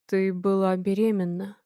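low, slow realization; slowly. A narrator's reading. Man realizes she was pregnant, slowly. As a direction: let it dawn on him slowly. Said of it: "медленно говорит он".

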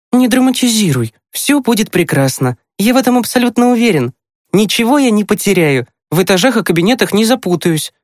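put-on high boy's voice, brushing off warnings; confident younger brother. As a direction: reassure a fussing sister in a high, brisk voice. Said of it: "поморщился Тимкин". (уверенный высокий мальчишеский голос)

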